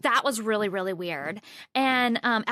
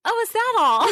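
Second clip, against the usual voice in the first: mock nonchalance; 11 semitones higher, 6 dB louder than usual. Joking about the haunted closet.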